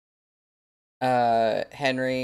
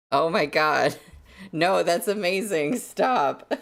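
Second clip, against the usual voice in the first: higher, louder, laughing squeals of delight; delighted disbelief; 6 semitones higher, 3 dB louder than usual. Real, not acted. Streamer thrilled by a fan's merch mock-up, excited laughing. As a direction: gush over a fan's design, half-laughing.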